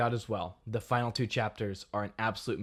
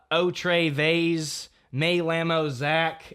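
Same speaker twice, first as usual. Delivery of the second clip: mock clumsy Spanish, louder and slow; exaggerated American accent on Spanish words; bad gringo Spanish; 5 semitones higher, 7 dB louder, much slower than usual. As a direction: imitate a kid butchering Spanish. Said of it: "not just going".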